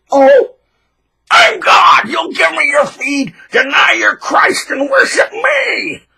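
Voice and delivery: high, loud, slow crying shout of a self-made god; mock megalomaniac. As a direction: rant like a tyrant demanding worship. Voicing a god-complex tyrant.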